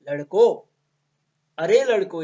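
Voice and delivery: put-on calling out to the boys; teacher calls the boys to come over, calling out. Shown as a demonstration. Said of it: "कहने का style भी थोड़ा सा इस तरह से होगा".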